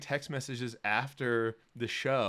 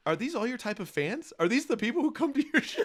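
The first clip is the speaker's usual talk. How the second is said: teasing question; 11 semitones higher than usual. Retold or imitated. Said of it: "Brent was like".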